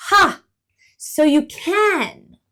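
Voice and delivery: loud, high delighted exclaim; delighted squirrel. Acted. Delighted squirrel exclaim. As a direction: squirrel discovers his breath, delighted.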